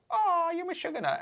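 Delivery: high, fond, delighted lilt; affectionate. Retold or imitated. A friend charmed by your madness, fondly. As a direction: tease them like it's a compliment. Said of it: "Meshuggah ways as like".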